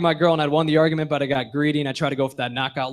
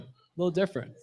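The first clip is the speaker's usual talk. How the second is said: quiet repeat; 11 dB quieter, faster than usual. Shown as a demonstration.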